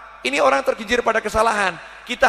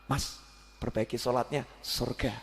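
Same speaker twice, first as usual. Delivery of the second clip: soft, low, gentle urging; preacher voices the kind adviser softly; gentle advice; 8 semitones lower, 12 dB quieter than usual. Retold or imitated. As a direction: coax him kindly, no blame. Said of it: "Yang satu".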